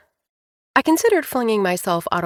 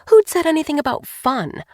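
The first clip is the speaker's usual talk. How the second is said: ordinary delivery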